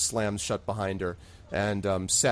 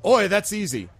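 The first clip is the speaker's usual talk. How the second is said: cheery bartender; 9 semitones higher, 6 dB louder than usual. Acted. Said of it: "And he says".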